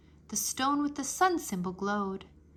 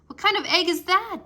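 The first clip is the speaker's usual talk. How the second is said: loud, higher wondering whoa; amazed; 6 semitones higher, 9 dB louder than usual. A narrator's reading. Drake amazed at the giant golden egg. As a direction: gasp at something huge and strange. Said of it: "Drake asked".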